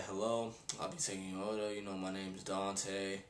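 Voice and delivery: mock bored waiter; rude waiter. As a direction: drone it out like you can't be bothered. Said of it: "in the tone of their voice".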